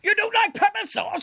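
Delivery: very high, incredulous announcer voice; Peter Dixon. Acted. Spoof announcer aghast at the interviewer's taste, in a high announcer boom. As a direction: boom the question in mock outrage. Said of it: "Take this encounter with X Factor announcer Peter Dixon".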